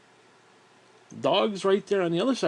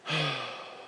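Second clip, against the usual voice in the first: soft, falling exhale; heavy sigh; 8 dB quieter than usual. Real, not acted. Winded storyteller resting between parts, soft heavy sigh. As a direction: let the breath drop out. Catching breath while recounting.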